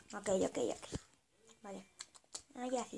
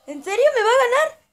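much higher and louder disbelief; indignant shout; 11 semitones higher, 9 dB louder than usual. Real, not acted. Gamer yelling in disbelief at the opponent winning. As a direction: yell it at the screen, outraged.

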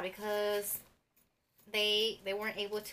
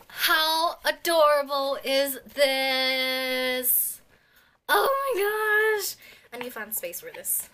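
thrilled; 5 semitones higher, 9 dB louder than usual. Real, not acted. Unboxing album, thrilled.

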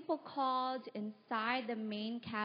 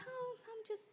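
high, soft little voice, playing it casual; acting sheepish, small and high; 11 semitones higher, 8 dB quieter than usual. Caught out of place among applicants.

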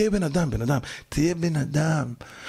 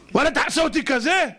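high, wowed request; acted eagerness; 7 semitones higher, 3 dB louder than usual. Newcomer asking gym to make him muscular, eager and amazed. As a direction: ask it high, thrilled by the idea.

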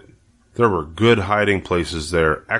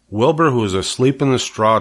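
usual speaking voice

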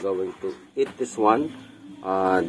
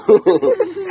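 short laugh; 9 semitones higher, 5 dB louder than usual. Real, not acted. Laughing at girl's refusal.

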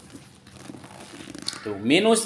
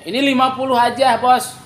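high, loud, quick price call; loud cheerful hawker calls; 8 semitones higher, 5 dB louder than usual. Real, not acted. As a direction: shout the price to buyers. Live seller calls out price.

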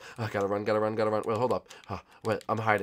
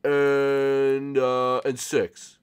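drawn-out mock-adult counting; manly voice; 4 semitones higher, 10 dB louder, much slower than usual. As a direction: count slowly in a fake man's voice. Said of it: "put on my manly voice".

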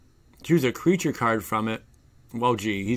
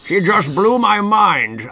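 higher, louder, slow amazed delivery; mind blown; 7 semitones higher, 7 dB louder, slower than usual. Real, not acted. Streamer marvels at a chatter's fact, slow and amazed. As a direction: savour the shock word by word. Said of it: "My mind is literally blown".

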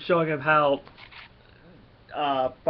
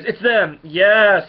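higher, louder triumphant cry; excited shout; 5 semitones higher, 9 dB louder, much slower than usual. Acted. Guard spotting the Guardians, loud excited yell. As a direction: yell it out like you caught them.

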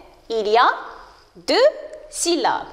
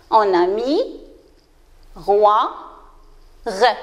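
"mi", "roi", "re" set apart by long pauses; syllable by syllable; 5 semitones lower than usual. Shown as a demonstration. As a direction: break "miroir" into mi, roi, re, each alone. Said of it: "De combien de syllabes se compose". (« mi », « roi », « re », syllabes bien détachées)

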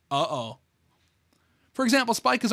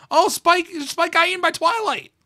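much higher, loud shocked outcry; 11 semitones higher, 6 dB louder than usual. Real, not acted. Gamer sees Spike eaten by Twilight, loud shocked outcry. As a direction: yell it out in horrified glee. Horrified reaction to game scene.

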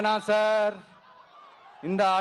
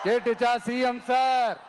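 shouted challenge with a falling sir; taunting call-out. Real, not acted. Speaker taunting the chief minister, a shouted call. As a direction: call it out across the crowd.

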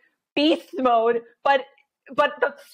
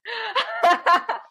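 laugh; 10 semitones higher than usual. Real, not acted. Laughing at her own story.

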